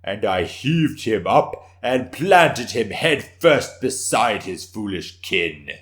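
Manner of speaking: triumphant declaration. Acted. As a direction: bellow the victory with pride. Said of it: "Suenaga declared triumphantly".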